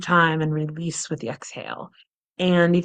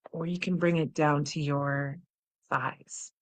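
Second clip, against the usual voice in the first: guided meditation; 2 semitones lower, 10 dB quieter than usual. Acted. Guided butterfly tapping.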